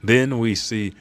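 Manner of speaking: ordinary talk, nothing marked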